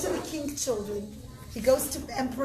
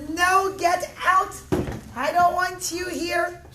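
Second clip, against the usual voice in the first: slow, loud, higher angry refusal; angry shout; 6 semitones higher, 5 dB louder, slower than usual. Acted. Host turning away Bar Kamsa's money, slow angry shout. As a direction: drag out the angry no.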